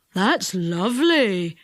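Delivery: put-on slow, low old man; old Saucepan. A narrator's reading. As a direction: admire it slowly like a deaf old man. Saucepan admires the liquid.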